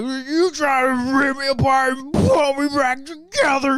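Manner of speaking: high, loud, slow slurred yell; drunk Rocket voice. Retold or imitated. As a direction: slur it like a drunk raccoon. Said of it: "Bradley Cooper does a great drunk voice".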